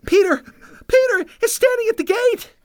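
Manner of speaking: put-on very high-pitched, excited young maid; breathless excitement. A narrator's reading. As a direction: burst out with the news, out of breath. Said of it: "out of breath".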